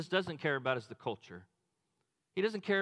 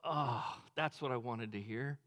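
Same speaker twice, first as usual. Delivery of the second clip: tender Jesus; 8 semitones lower, 5 dB quieter than usual. Pretend. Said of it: "a part of Jesus ... that just went".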